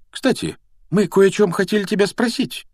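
high, light, offhand question; feigned casualness. A narrator's reading. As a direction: bring it up as if by chance. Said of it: "как бы невзначай произнёс Гарри".

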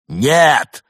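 loud, high, drawn-out denial; exasperated shout. A narrator's reading. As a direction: burst out in frustration. Exasperated customer, glasses still fail.

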